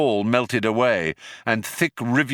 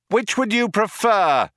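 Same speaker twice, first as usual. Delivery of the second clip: high-pitched shouted retort; 12 semitones higher than usual. A narrator's reading. Ford answers the cop's ultimatum, shouted. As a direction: shout the question back. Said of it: "shouted Ford".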